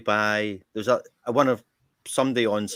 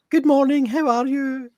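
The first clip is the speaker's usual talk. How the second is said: shopkeeper's greeting; 7 dB louder than usual. Retold or imitated. Shopkeeper greeting a customer, high mimicking sing-song. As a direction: imitate her with a high, lilting hello. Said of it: "And she says".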